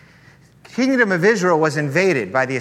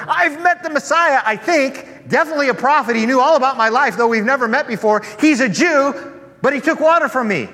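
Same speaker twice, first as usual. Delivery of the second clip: high, loud and breathless with news; bursting to tell the whole village; excited gossip; 9 semitones higher, 6 dB louder than usual. Acted. Woman telling the villagers she met the Messiah, excited and high.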